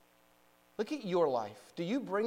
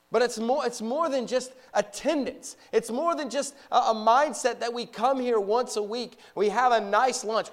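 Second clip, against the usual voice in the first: raised, higher-pitched preacher's voice; forceful preaching; 7 semitones higher, 8 dB louder than usual. Real, not acted. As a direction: preach it out to the whole congregation.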